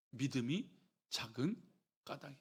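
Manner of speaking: soft, low "믿음이 작은 까닭이라" with pauses between words; deliberate understatement. Acted. Preacher delivering Jesus' simple answer, "믿음이 작은 까닭이라" hushed and spaced out. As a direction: break "믿음이", "작은", "까닭이라" apart, quietly.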